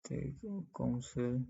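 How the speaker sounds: ordinary talk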